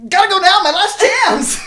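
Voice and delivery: high, loud frantic squeal; panicked deer voice. Retold or imitated. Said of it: "they go".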